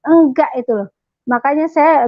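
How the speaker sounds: ordinary delivery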